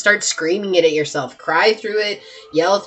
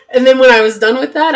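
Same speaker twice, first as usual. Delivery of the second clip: ordinary delivery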